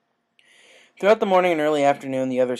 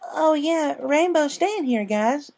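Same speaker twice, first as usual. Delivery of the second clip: put-on high quick child blurt; blurted filly voice; 4 dB louder, slower than usual. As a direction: spill the secret. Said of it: "blurted out".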